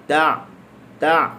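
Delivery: "ta" with mouth wide, closed on a throaty ain; wide-open mouth. Shown as a demonstration. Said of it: "buka mulut luas". ("ta" dengan mulut dibuka luas)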